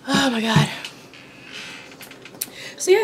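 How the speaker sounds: ordinary delivery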